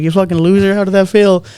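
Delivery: teasing retold jeer; mock taunting. Girlfriend's retold taunt of the man.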